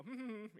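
high, loud humming to drown out the call; dismissive humming. Retold or imitated. Ignoring God's nudge by humming, high loud hums. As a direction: hum along to ignore what you hear. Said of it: "for me, I just go".

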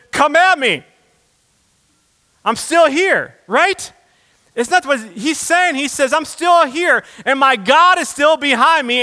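loud, high, rousing sermon delivery; full-force preaching. Real, not acted. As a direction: build to a defiant shout of faith, then drive it home.

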